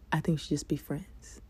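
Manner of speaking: breathy hushed whisper of her reply; soft voiceless whisper. Retold or imitated. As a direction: drop to a whisper for the punchline. Quoting the girl's rejection line.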